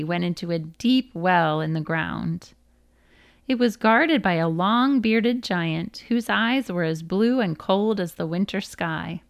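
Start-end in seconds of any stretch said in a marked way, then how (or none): none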